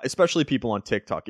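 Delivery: usual speaking voice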